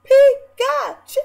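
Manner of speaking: high mock cartoon cry; cartoon Pikachu voice. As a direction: squeal it like a cartoon creature, stretching the second one. Mocking her voice as a cartoon creature.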